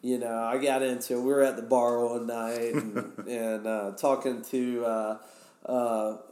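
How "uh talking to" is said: normal talking voice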